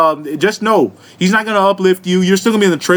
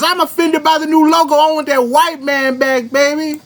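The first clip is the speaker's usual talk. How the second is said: high, whiny put-on voice; hammed-up high-pitched outrage; mock offended customer; 9 semitones higher than usual. Mocking an offended customer over the new logo, mock offended voice.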